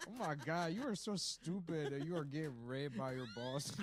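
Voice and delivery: hammed-up Bronx woman accent. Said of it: "continues Bronx woman".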